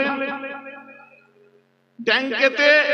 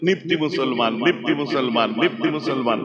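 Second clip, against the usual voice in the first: low, sneering chant of a jeer; a hypocrite's jeer at the Muslims, mocking and low; mocking taunt; 11 semitones lower, 4 dB quieter than usual. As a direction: repeat it like a scornful insult, pitched low. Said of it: "কটাক্ষ করত নিপ্তি নিপ্তি করে".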